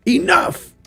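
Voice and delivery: very high mock command; commanding cry. Angelic figure descends and stops the sentinel, commanding cry. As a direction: cry out to halt a battle.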